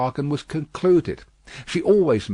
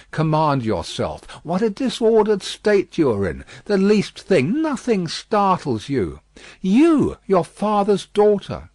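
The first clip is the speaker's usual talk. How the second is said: higher, louder firm rebuke; steady her with a firm word; chiding father; 8 semitones higher, 5 dB louder than usual. Acted. The doctor steadies his daughter, higher and firm.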